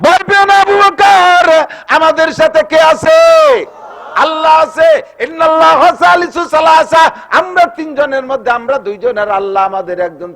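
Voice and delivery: high, loud rallying cry; ringing sermon shout, easing on the last line; full-force preaching. Acted. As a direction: proclaim it to a whole crowd at the top of the voice. Prophet's reassurance cried out in sermon.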